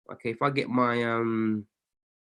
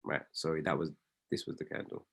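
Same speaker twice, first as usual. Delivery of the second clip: whispered; 12 semitones lower, 14 dB quieter than usual. Real, not acted. Correcting himself under breath.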